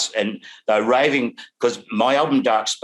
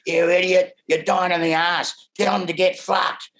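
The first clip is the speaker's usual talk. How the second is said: imitating drunk manager; 9 semitones higher than usual. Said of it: "And he goes".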